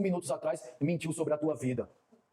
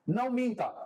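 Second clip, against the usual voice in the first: voice of the Spirit; 7 semitones higher, slower than usual. Retold or imitated. Holy Spirit repeating an order to a convert, forceful raised command. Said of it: "O Espírito Santo falava assim pra mim".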